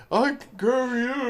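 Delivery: mocking Sam. Mocking Sam's line.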